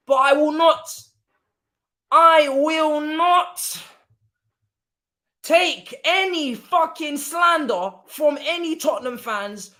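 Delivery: slow and loud, hammer home each word; angry rant. Real, not acted. Football fan warning Tottenham fans, loud and slow.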